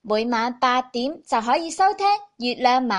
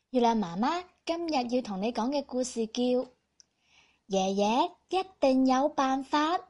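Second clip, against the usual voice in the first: put-on reading to children; 2 semitones lower, 6 dB quieter than usual. Storyteller greets children softly.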